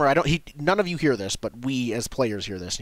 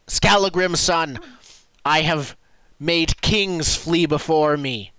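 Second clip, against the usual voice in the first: loud, higher, proud warrior's challenge; boastful warrior; 5 semitones higher, 6 dB louder than usual. Acted. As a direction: boast loudly to the whole crowd. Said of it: "Egil says".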